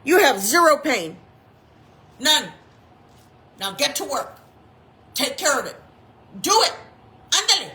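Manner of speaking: self-command. Retold or imitated. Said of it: "I went ahead and I said".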